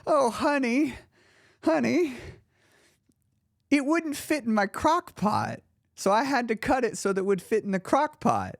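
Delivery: lightly voiced grandma, amused by her grandchild's question. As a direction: let a grandmother gently explain the obvious. Grandma explains the roast.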